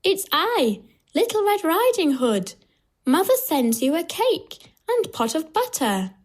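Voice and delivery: put-on high little-girl voice; high, innocent storybook girl. A narrator's reading. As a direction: announce yourself as a high-voiced child. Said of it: "And she says".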